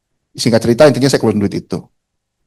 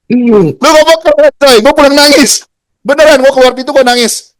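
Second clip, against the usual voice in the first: laughing, much higher and louder, slowed at the start; 12 semitones higher, 4 dB louder than usual. Real, not acted. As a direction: crack up at how you cried all the way home. Laughing at his own tearful exit.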